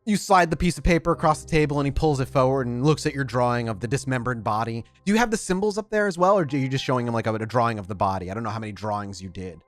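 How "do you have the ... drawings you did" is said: normal talking voice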